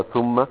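"ثم" pronounced thumma, with a u; damma on tha. Shown as a demonstration. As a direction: round the first vowel of "ثم": thumma. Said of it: "بالضم". ("ثم" بضم الثاء)

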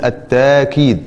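التاكيد with a long open "aa", at-taa-keed; with an alef. Shown as a demonstration. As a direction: hold the "taa" of التاكيد, no glottal stop. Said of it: "وبالألف تقول".

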